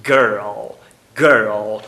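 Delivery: put-on girl with all four sounds said carefully; careful American girl; articulated. Shown as a demonstration. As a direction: say girl slowly with the R. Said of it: "articulando os quatro fonemas".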